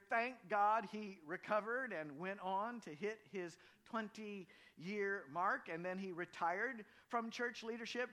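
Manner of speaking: loud, high, rousing sermon climax; fiery preaching. Acted. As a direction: build to a thundering call from the pulpit. Preaching at full force.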